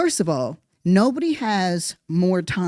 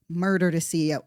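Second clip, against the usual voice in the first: ordinary delivery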